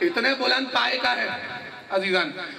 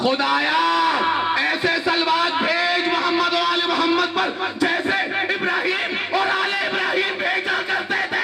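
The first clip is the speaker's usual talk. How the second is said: fiery preaching; 8 semitones higher than usual. Real, not acted. Preacher proclaiming the meaning to the congregation, fiery raised preaching. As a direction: drive the point home to the crowd.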